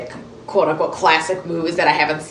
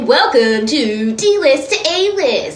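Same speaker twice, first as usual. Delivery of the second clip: high, slow announcer welcome; mock TV host; 6 semitones higher, 4 dB louder, slower than usual. Host opening a made-up celebrity segment, mock announcer. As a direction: draw out the show title like a TV presenter.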